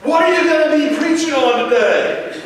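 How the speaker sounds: put-on a wide-awake kid with a question; curious child. Son questions dad.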